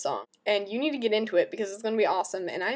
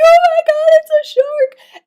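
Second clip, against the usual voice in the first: much higher, much louder shrieking panic; mock terrified scream; 14 dB louder than usual. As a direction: scream it like you've just spotted a shark. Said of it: "We're like".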